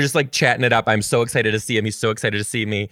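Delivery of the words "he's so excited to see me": ordinary talk, nothing marked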